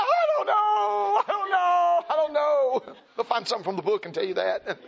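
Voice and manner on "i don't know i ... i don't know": put-on high, loud, slow wailing child; scared child. Terrified child answering an upset parent, high loud wail.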